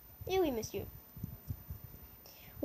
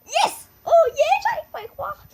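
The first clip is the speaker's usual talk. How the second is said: high, loud "Yes, oh yeah"; victory shout; elated cheer; 12 semitones higher, 11 dB louder than usual. Real, not acted. Vlogger celebrating a right guess, loud high cheering.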